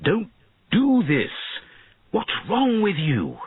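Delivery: high, drawn-out earnest plea; urgent pleading. A narrator's reading. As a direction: plead with a wayward pupil. Mentor pleads with his fallen pupil.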